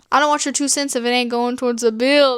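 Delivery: usual speaking voice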